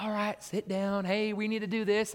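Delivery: lightly acted substitute trying to get order; mild teacher plea. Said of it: "I'm kinda trying to explain to them".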